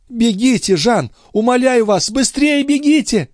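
very high, frantic plea; desperate urging; urgent pleading. A narrator's reading. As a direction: beg him to hurry, voice pitched right up. Countess begs Jean to hurry.

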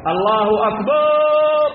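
put-on old man's drawn-out call. Shown as a demonstration. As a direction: sing out أكبار with a long alif. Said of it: "فيمد الألف قبل الراء".

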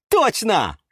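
high, happy exclamation; delighted. A narrator's reading. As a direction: agree with sudden joy. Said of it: "обрадовался Юра". (радостно, высоким голосом)

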